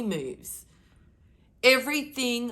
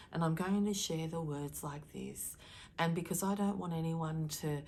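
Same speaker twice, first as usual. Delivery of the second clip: soft monotone demo, much softer; light and fluffy; 2 semitones lower, 6 dB quieter than usual. Shown as a demonstration. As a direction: show how dull a too-soft delivery sounds. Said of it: "If I spoke like that through the whole video".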